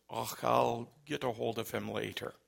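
quiet Scottish caller imitation; thick Scottish accent. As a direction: sign off softly as a put-on Scot. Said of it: "had a very thick Scottish accent".

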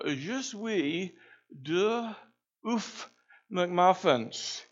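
high, strained, mangled French order; awkward high-pitched French, not quite right; clumsy schoolboy French. Retold or imitated. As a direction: botch the French like a rusty student. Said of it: "I sounded like a sick dog".

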